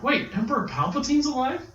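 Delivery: put-on high, incredulous kid voice; high mock kid outrage; imitating a kid. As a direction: squeak it out like a shocked kid. Twins react to the crawl.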